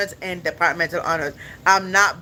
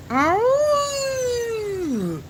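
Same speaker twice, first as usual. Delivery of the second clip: high, drawn-out imitated howl; wolf howl. Streamer showing it sounds like wolf, high howl.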